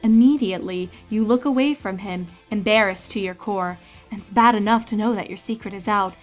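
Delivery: ordinary delivery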